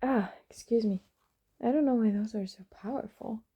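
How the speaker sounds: ordinary delivery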